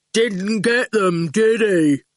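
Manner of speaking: slow smug thug; gloating goon. A narrator's reading. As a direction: drag it out, pleased with himself.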